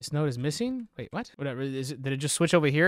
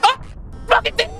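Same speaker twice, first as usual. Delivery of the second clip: high startled yelp; 6 dB louder than usual. Real, not acted. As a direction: jump at the scare, yelp it. Startled by jump scare.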